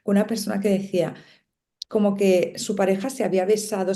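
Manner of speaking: usual speaking voice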